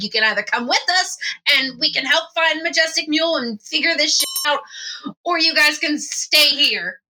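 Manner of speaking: high-pitched, insistent ultimatum; pitched well up, pressing them to choose; insistent character. Acted. Hermie telling the party to come or stay, high and insistent.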